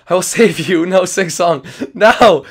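usual speaking voice

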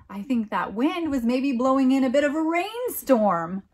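ordinary delivery

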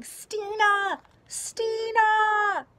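loud, high desperate call. A narrator's reading. Grandfather searching the dark for Stina, shouting. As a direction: shout her name into the storm. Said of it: "He rushed out of the house calling".

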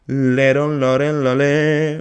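a light nasal, throaty [name] imitation, slow; imitating [name]'s voice. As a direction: hum the syllables like [name], slowed down. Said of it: "Un poquito nasal y un poquito engolada". (imitando a [name], un poquito nasal y engolada)

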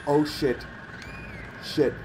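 sudden frightened outburst; startled curse. Real, not acted. Startled by a jump scare.

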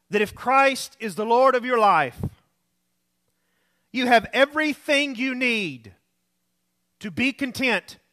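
usual speaking voice

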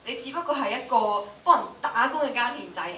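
normal talking voice

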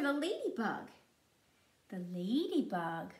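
usual speaking voice

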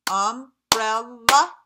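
"Umbrella" in three slow, loud beats, rising; "Umbrella" chopped slowly into three; syllable by syllable. Shown as a demonstration. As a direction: punch each syllable of "Umbrella" for a clap. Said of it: "Three syllables".

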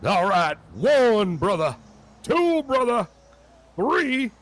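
loud countdown climbing to a high cry; exorcist preacher. Pretend. Reverend casts the devil out, building revival shout. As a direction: belt each count out, building to the climax.

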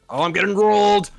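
high, loud panic; panicked yell. Real, not acted. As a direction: yell it in alarm. Losing the fight, yells.

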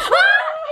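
high laughing scream. Real, not acted. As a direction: shriek half laughing, half scared. Spider still on swimsuit.

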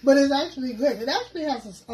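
speaking with food in mouth; words around a bite of food; talking while chewing. Real, not acted. Seen 1: left speaker handles wings, mouth working between bites throughout.

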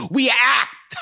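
hammed-up stage flourish; theatrical proclamation. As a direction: declaim it like a hammy thespian. Proclaims the actor's craft theatrically.

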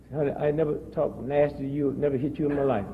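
low, controlled mock menace; quiet threat. Husband warning his wife over the phone, low mock threat. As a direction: keep it down low while threatening. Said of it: "I never heard you talk like that".

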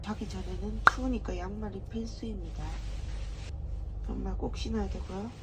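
soft night-time murmur; hushed voice. Real, not acted. Hushed talk in sleeping berth.

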